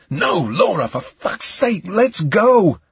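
exasperated plea rising to a shout; urgent shouting. A narrator's reading. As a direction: urge her to run, desperately. Urging struggling Laura to flee.

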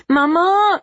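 high, loud frantic cry; panicked call. Acted. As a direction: scream for his mother in panic. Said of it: "he called, panicked".

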